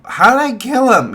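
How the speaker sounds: put-on high, loud, shocked girl's squeal; a girl outraged at the accusation; squeaky mock innocence; indignant girl. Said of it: "she goes".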